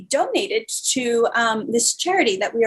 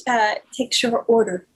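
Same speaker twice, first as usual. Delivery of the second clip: usual speaking voice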